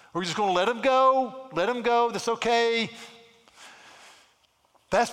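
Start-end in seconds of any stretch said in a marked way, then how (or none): 0.1-2.9 high, loud mock dismissal; acted avoidance. Preacher mimics churchgoers letting a sinner go, mock dismissive. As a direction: voice people shrugging someone off, raised and loud. Voicing people who avoid confronting.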